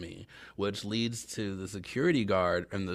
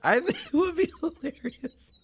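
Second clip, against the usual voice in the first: high giggly laughter through words; giggling fit, squeaky and bright; laughing; 4 dB louder than usual. Real, not acted. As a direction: laugh helplessly while apologizing. Laughing at own leprechaun joke.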